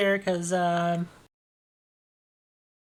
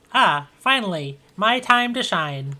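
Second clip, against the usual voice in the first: eager, slow, higher robot voice; 4 semitones higher, 6 dB louder, slower than usual. Acted. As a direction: announce it like a proud machine.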